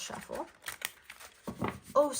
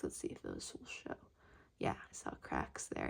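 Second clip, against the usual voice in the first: whisper; 11 dB quieter than usual. Real, not acted. Aside while checking egg card.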